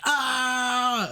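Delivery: high yelled ah; mock battle cry. Mimicking the character shooting into the air, high yell. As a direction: yell it while firing upward. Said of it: "and she's like".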